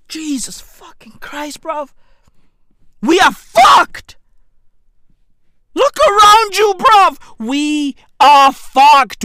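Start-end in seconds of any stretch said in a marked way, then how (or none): 3.0-9.2 exasperated shout. Acted. Despairing rant about South Africa.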